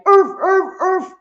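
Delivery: very high, sharp mock dog yelps; imitated hurt-dog yelps. Streamer retelling the fake dog-harming act, high mocking yelps. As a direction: yelp like a hurt dog, three times. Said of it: "making sounds like the dog is hurt".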